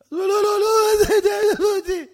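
high, loud stammered protest; frantic captive begging off; panicked pleading. Acted. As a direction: stammer it out in hammed-up terror.

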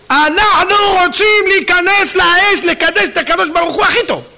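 hammed-up heroic cry of devotion; fervent declaration. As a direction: proclaim it with rising zeal, high-pitched. Frogs' heroic declaration acted out.

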